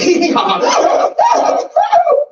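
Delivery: high shrieking mock laugh; a hammed-up falsetto cackle; imitated weird laugh. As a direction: belt out a bizarre squealing laugh. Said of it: "I collect strange and unusual laughs".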